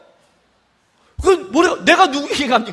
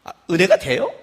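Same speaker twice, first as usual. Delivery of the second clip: normal talking voice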